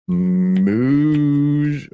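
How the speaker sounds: "muse-" with a long mooing first vowel; cow-like moo. Pretend. Making another moo joke, "muse-" drawn out as a moo. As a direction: stretch "muse-" into a cow's moo. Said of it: "we're gonna do another moo joke".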